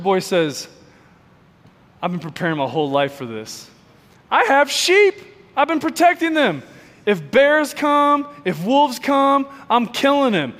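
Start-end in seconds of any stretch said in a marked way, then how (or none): 2.0-10.5 eager boast. Retold or imitated. Shepherd boy boasting to king.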